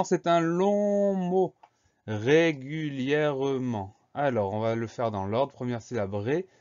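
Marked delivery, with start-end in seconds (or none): 0.0-1.5 "long" stretched out high and slow; drawn-out LONG. Shown as a demonstration. Teacher introducing a long word to pupils, drawn-out "long". Mot long étiré pour l'illustrer. 2.1-3.9 "Régulièrement" drawn out syllable by syllable; slow dictation. Shown as a demonstration. Teacher presenting a long word to spell, slow dictation. Dictée du mot, syllabe par syllabe.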